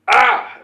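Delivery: short, loud, high "Ah!"; imitated scream of sudden panic; mock panicked yell. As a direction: yelp it out in alarm. Miles's shocked cry, retold.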